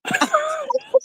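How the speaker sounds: squealing laugh; shrieking high laugh. Real, not acted. Laughing at crow's feet.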